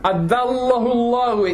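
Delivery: fast, chanted Quranic recitation set apart from his talk; tajweed recitation. Acted. Teacher reciting the verse before explaining it, in a chanted tajweed voice.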